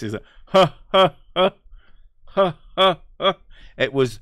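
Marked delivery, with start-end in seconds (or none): 0.5-3.4 loud, high mock "ha, ha" laugh; imitated obnoxious laugh. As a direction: mimic the neighbour's obnoxious, repetitive laugh. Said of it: "laugh like this".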